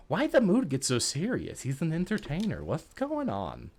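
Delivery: light, lisping cartoon duck voice; a hint of a spluttering cartoon duck; Daffy Duck voice. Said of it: "I'm apparently doing a Daffy Duck impression now".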